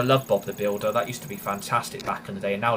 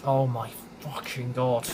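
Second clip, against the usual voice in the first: ordinary delivery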